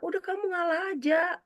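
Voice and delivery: high-pitched mock parental urging; parent telling off. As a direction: act a parent pushing the older child to yield. Voices parent telling elder to give in.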